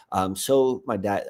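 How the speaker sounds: usual speaking voice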